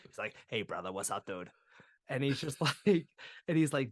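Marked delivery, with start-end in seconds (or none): 0.5-1.5 low Boston bro greeting; Boston buddy saying hey; thick Boston accent. Acted. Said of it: "he's got the thickest Boston accent".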